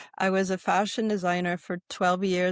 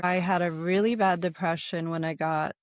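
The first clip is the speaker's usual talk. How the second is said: normal talking voice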